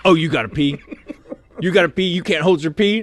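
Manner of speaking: high, loud mocking disbelief; mocking drill sergeant. Drill sergeant mocking a recruit who needs to pee, high and loud. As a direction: taunt him like a drill sergeant.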